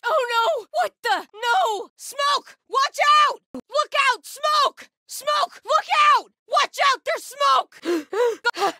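high, urgent yell of danger; alarmed warning shout. Acted. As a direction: shout a warning across the fight. Shouted warnings about smoke.

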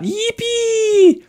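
high, excited squealing cheer. Real, not acted. Streamer cheering lucky block time, high squeal. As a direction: squeal with glee.

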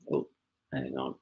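low, very soft mutter to oneself; muttered aside. Real, not acted. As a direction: drop to a barely audible aside. Muttered aside over slide mishap.

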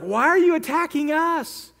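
high, pleading cry of alarm; shocked, begging them to stop; alarmed villagers. Retold or imitated. Frightened villagers of Lehi cry out to the attacking Philistines.

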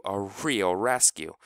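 plain reading voice

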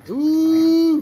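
sudden high loud ooh. Real, not acted. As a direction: react as the dog gets got. Reacting to dogs wrestling.

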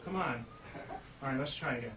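normal talking voice

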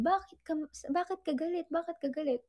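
lightly voiced confused boyfriend pressing for an answer; puzzled partner asking. Partner asks why she is angry.

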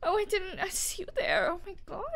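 super high-pitched, timid cutesy squeak; uwu voice. Shown as a demonstration. Player shows friend how to do it, uwu voice. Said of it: "the super high-pitched, like, uwu voice".

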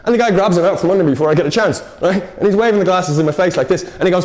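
ordinary talk

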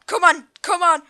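ordinary talk

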